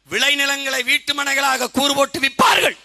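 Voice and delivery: imitated full-force prophetic oratory, high and loud; Muthuramalinga. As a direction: shout the prophecy in an orator's sustained cry. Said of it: "பேசியிருக்காரு". (தீர்க்கதரிசனப் பேச்சாளராக உரத்த முழக்கம்)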